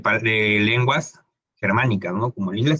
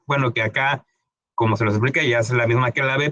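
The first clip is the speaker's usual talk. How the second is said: usual speaking voice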